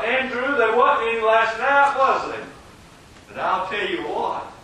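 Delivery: loud, high-pitched pleading from the pulpit; fervent altar-call preaching. Real, not acted. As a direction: beg the congregation to come forward.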